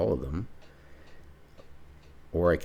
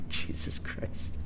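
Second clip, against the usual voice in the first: slow exasperated breath; much slower than usual. Real, not acted. Crewman passed out again.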